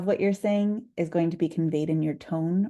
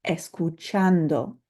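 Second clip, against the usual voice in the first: escuchando with chan stressed, slow; slow model word, stress on chan; slower than usual. Shown as a demonstration. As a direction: lean on the chan in escuchando, slowly. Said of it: "that's the syllable that we're going to emphasize".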